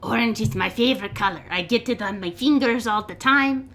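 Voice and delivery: quick, high, loud goblin voice. Acted. Goblin tells the elf about a favorite color, excited goblin voice. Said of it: "a goblin in that cell".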